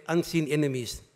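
ordinary delivery

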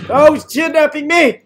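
loud high mock alarm; alarmed yell. Real, not acted. Player grabbed by enemy yells in alarm. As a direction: shriek as you're grabbed.

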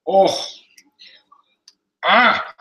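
high, loud yelps of pain; pained cries. Real, not acted. Burned vlogger reacting to pain on livestream, pained cries. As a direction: cry out sharply at a burn.

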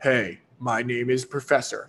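a light mock of a droning lecturer, slowing at the end; boring professor. Teasing viewers about dull professors, a light droning mock. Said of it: "this professor's gonna talk like this".

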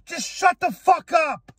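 high, angry shout at the haters. Real, not acted. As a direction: bark it at them.

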